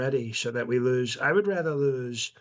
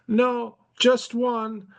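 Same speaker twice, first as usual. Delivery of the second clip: put-on very high, very slow European accent; drawn-out put-on European accent; Barron with a European accent; 10 semitones higher, slower than usual. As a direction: son calmly explaining the killings in a high European accent. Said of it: "he speaks with a European accent".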